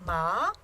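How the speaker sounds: MA rising like a questioning yes; second tone. Shown as a demonstration. Teacher likening tone to an inquiring yes, rising MA. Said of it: "Think of yes".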